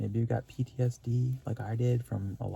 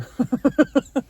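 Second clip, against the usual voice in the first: a high, loud, real burst of laughter; 11 dB louder than usual. Real, not acted. Laughs recalling gratitude.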